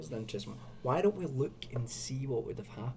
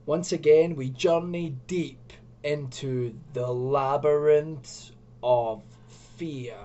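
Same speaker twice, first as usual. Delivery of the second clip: dramatic intro; 2 semitones higher, 6 dB louder, slower than usual. Acted.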